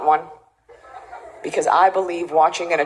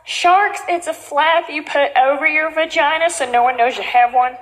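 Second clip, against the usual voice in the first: perky contestant selling to the sharks; Shark Tank pitch; 10 semitones higher than usual. Acted. Contestant pitches her product to the sharks, salesy pitch voice.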